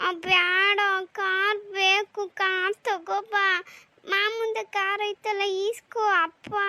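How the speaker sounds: high, slow pleading child voice, lightly voiced; whiny child. Little daughter begging her father for a car, slow whiny child voice. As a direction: drag the words out, begging for the car.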